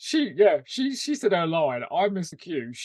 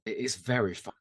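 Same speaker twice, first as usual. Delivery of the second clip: ordinary talk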